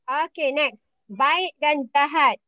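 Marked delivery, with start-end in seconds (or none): none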